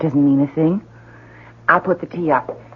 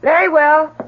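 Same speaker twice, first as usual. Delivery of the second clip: calling back; 13 semitones higher, 6 dB louder than usual. Acted. Wife calling back to husband.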